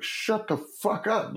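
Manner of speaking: high, slow, put-on boss voice; mock exasperated boss. Boss brushing off his offer to be fired, mock exasperated. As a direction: stretch it out like a fed-up older man.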